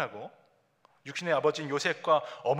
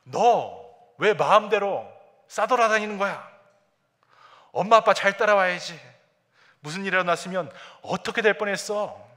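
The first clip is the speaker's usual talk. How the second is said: higher, louder motherly scolding; 5 semitones higher, 9 dB louder than usual. Retold or imitated. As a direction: tell off a runaway child. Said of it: "야단치고 있는 것입니다". (아이를 야단치는 엄마 목소리)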